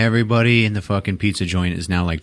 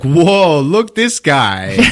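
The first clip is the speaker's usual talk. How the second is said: high, loud and slow, showing off his friend; loud wide-eyed amazement; amazed whoa; 7 semitones higher, 6 dB louder, much slower than usual. Retold or imitated. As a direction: marvel out loud to the whole restaurant. Tommy amazed at Greg's performance.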